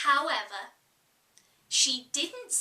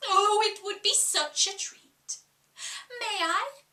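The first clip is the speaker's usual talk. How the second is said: higher, eager little coo; cooing like a pigeon; 7 semitones higher, 4 dB louder than usual. Acted. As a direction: coo it out sweetly. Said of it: "like the cooing of a pigeon".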